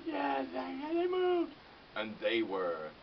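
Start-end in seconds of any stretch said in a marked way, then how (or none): 0.0-1.5 high, loud mock cheer building on moved; gleeful cheer. Comedian cheers that noisy neighbours moved, high mock glee. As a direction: celebrate like a kid let out of school.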